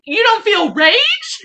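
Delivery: incredulous, hammed-up shock; horrified disbelief. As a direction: ask it like he's from another planet. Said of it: "I was so horrified".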